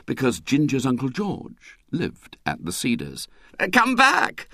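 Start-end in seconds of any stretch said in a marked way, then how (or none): 3.6-4.5 put-on high calling voice after the boys. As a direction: call them back across the dark. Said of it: "called Mister Solomon through the night".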